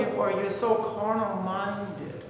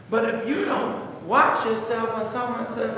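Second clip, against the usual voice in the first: ordinary talk, nothing marked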